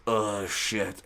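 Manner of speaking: low-volume sinking groan of recognition; retold quiet dread. Coach spots the fighter coming for him, hushed dread. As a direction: say it under your breath, dreading what's coming.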